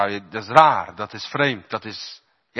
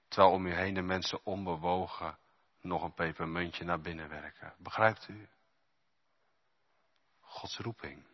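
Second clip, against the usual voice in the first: quiet; 5 semitones lower, 10 dB quieter than usual. Acted. Quiet intimate preaching.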